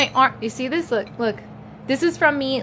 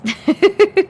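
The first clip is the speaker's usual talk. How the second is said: loud high laugh; 9 semitones higher, 6 dB louder than usual. Real, not acted. Laugh after joke to babies.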